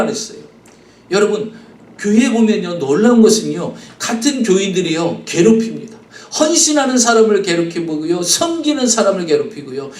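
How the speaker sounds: normal talking voice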